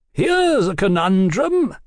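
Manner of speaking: slow, high, grandly condescending; ornate, superior tone; elaborate, lofty announcement. A narrator's reading. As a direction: announce it as pearls before swine. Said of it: "in the elaborate tone of a man".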